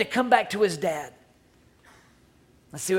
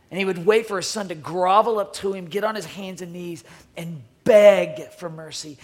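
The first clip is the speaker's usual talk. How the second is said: normal talking voice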